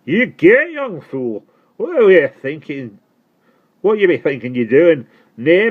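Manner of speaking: put-on loud, high Dorset accent, scolding; strong Dorset accent. Postman scolds the boy after the near crash, loud Dorset accent. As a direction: rant at the boy in thick Dorset. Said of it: "in his strong Dorset accent".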